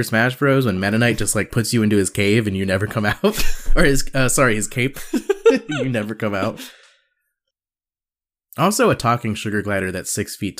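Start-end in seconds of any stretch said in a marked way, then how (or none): 5.0-5.6 squeaky high laugh; high burst of laughter. Real, not acted. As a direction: let out a high cackle. Laughing at his own slip.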